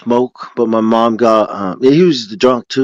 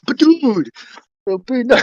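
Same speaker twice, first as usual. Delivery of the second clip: excited; 13 semitones higher than usual. Real, not acted. Excited about picking a gang.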